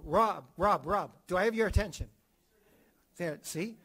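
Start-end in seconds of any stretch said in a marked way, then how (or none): 0.0-2.1 calling out. Shown as a demonstration. Calling out to Rob.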